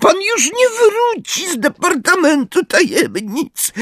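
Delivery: very high creature voice; relishing house-elf. A narrator's reading. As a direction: savour it in a squeaky elf voice. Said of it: "powiedział z lubością". (wysoki głos skrzata, z lubością)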